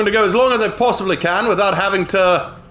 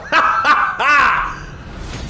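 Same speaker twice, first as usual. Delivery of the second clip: laughing; 7 semitones higher than usual. Real, not acted. Laughing at his Gryffindor joke.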